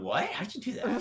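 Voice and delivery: high, surprised mimic; mock surprise. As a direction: imitate his shocked reaction. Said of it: "Even he's going".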